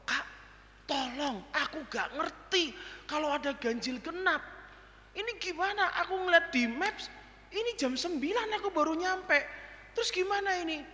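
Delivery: high, anxious pleading on the phone; calling the pastor in a panic, high and anxious; panicked plea. Retold or imitated. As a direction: beg for help, flustered.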